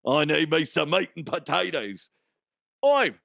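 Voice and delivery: hammed-up mock Cockney; exaggerated Cockney accent. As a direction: put on a silly Cockney. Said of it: "It'd be like Cockney".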